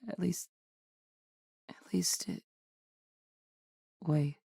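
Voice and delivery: hushed, halting murmur to oneself; trailing off uncertainly. Acted. Researcher puzzling over a sample, hushed and hesitant. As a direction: falter softly with long pauses. Scientist falters examining sample.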